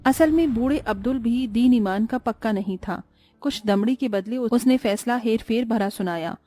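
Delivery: plain reading voice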